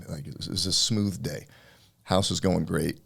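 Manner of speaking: ordinary talk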